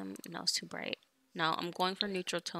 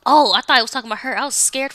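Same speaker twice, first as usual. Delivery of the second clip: excited; 5 semitones higher, 13 dB louder, faster than usual. Real, not acted. Startled by game dialogue.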